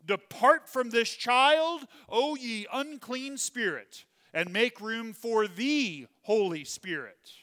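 slow, loud, high commanding cry; commanding exorcism rite. Shown as a demonstration. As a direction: cast out the spirit with full force. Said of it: "would go something like this".

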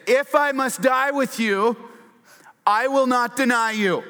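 high, loud, fervent pledge; emphatic vow. Retold or imitated. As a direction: swear loyalty with fist on the table. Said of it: "Peter said emphatically".

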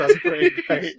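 high, slow, laughing delight. Real, not acted. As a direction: laugh through the words. Laughing at the Chewie joke.

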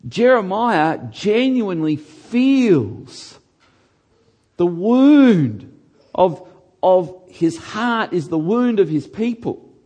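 loud, slow, heartfelt preacher cry; impassioned preaching. Real, not acted. Preaching at full force.

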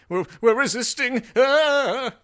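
high, panicked wail; hammed-up high yelp of defeat; mock losing resister. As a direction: cry out as if being overrun. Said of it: "resist implies that you are losing".

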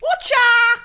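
high, loud cheeky cockney hello; loud cheeky Essex greeting. Pretend. Denise Van Outen voiced.

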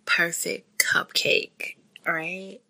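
slow, whispered adoring words; tender whisper. Real, not acted. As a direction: breathe it out, full of love. First sight of newborn daughter.

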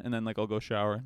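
ordinary talk

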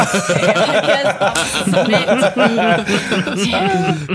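giggling surrender, drawn out; laughing. Real, not acted. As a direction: laugh through the words. Laughs at own joke.

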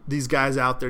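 ordinary talk, nothing marked

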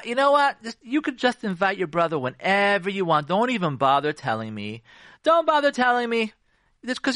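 high, over-sweet martyred sarcasm; heavy sarcasm. Acted. Wife answering her husband's "upset at me?", sarcastic. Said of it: "that's called sarcasm".

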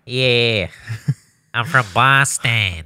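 stuffed-nose Boston accent. Shown as a demonstration. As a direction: exaggerate a congested Boston accent. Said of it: "like, a stuffed nose".

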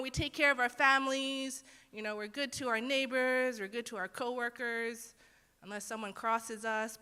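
ordinary talk, nothing marked

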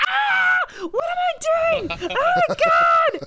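very high, loud shocked shriek; panicked shriek. Retold or imitated. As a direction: shriek in mock panic, dragging out the end. Panicking on seeing strippers.

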